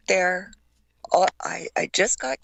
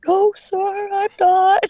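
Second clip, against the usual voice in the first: timid recruit; 13 semitones higher, 6 dB louder, slower than usual. Retold or imitated. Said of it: "I'm like".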